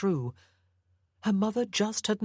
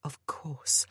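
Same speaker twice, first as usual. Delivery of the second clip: hushed realization, breathed out; whispered thought; 2 semitones lower, 4 dB quieter than usual. A narrator's reading. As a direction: whisper it as it dawns. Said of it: "a thought struck her".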